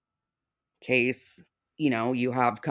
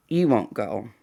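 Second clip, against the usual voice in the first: normal talking voice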